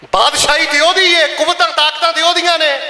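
high, ringing preaching; fervent proclamation. Acted. Preacher proclaiming God's power to worshippers, fervent.

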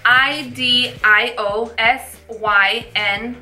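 slow letter by letter; spelling it out. Shown as a demonstration. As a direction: spell each letter out slowly. Spelling the word out.

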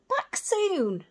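high little owl voice; Percy the owlet. Acted. Percy agrees with Sarah, high owl voice. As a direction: echo hopefully. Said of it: "said Percy".

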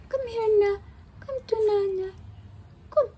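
high coaxing call. Real, not acted. Coaxing the pet.

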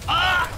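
short, high, loud scream of alarm; sudden high shriek; startled yell. Real, not acted. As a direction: yelp loudly as you get hit. Attacked in game.

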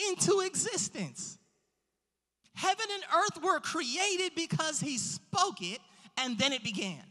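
forceful pulpit encouragement; emphatic preaching. Real, not acted. Preacher encouraging the congregation about a limitless God, emphatic preaching. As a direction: preach it with drawn-out key words and big pauses.

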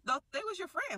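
high, puzzled disbelief; a sister caught off guard, thrown by the news; confused. Retold or imitated. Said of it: "like, confused".

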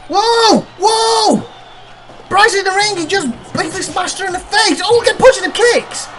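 high-pitched, outraged play-by-play; alarmed commentator. Pretend. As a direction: cry out in shock at a cowardly attack. Commentator reacts to sneak attack.

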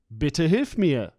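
ordinary delivery

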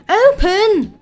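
high, loud demand to open up; urgent shout. Pretend. Player yells at a helicopter that won't open, urgent shout.

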